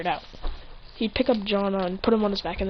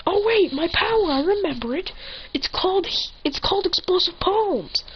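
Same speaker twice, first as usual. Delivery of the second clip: high, eager story-hero voice; pitched-up burst of remembering; sudden realization; 10 semitones higher than usual. Acted. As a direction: announce your power like an anime hero. Hero remembers his power.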